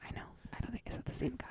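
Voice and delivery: hushed whispered question. Real, not acted. Nervous host checking who the intruder was, whispering. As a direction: whisper so he won't overhear. Secret aside after the interruption.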